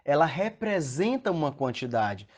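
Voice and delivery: ordinary talk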